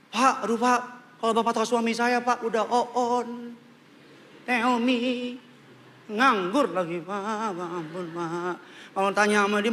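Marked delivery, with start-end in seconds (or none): 0.1-8.6 drawn-out mock-despairing whine; whining wife. Wife complaining to pastor about husband, hammed-up whine. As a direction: moan and plead, stretching the final words.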